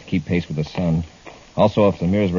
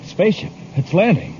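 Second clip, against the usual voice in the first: much higher, sudden alarm at the sky; startled; 11 semitones higher than usual. Acted. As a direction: blurt it out as you spot it. Sudden sighting of landing ship.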